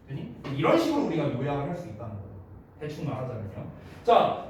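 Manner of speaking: usual speaking voice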